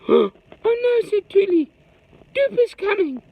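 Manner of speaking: high loud scared squeal; frightened cry. Acted. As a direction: panic that Duper's coming. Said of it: "she was really scared".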